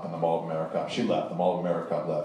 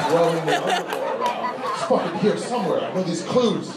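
high-pitched, hammed-up frantic outburst; frantic searching; 8 semitones higher than usual. Clue hunters tear through the underwear aisle, frantic and high. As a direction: act out a desperate hunt, voice jumped way up.

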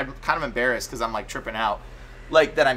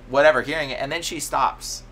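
normal talking voice

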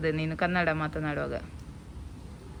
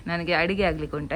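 ordinary delivery

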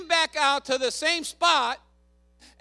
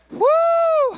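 high, loud "woo"; praise whoop; 8 dB louder than usual. Real, not acted. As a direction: let out an exultant whoop. Preacher whoops after shouted verse.

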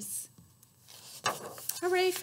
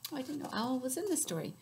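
usual speaking voice

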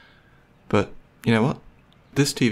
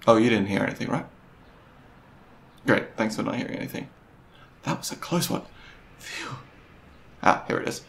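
ordinary talk

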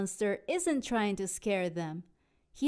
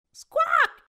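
high screechy parrot cry; parrot squawk; 9 dB louder than usual. Acted. Parrot cries as the wave hits, high screech. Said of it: "cries the parrot".

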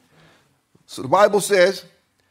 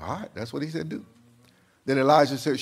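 ordinary delivery

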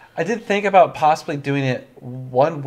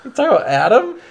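laughing; 8 semitones higher, 6 dB louder than usual. Real, not acted. Laughing at a joke.

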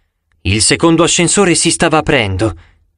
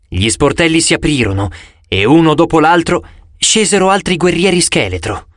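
plain reading voice

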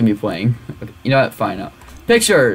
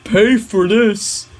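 pushy app pop-up. Acted. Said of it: "the thing's like".

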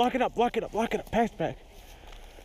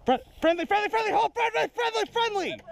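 urgent; 11 semitones higher than usual. Real, not acted. Stopping friendly fire.

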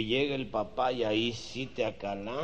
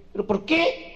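put-on girlfriend pleading; 9 dB louder than usual. Girlfriend asks again.

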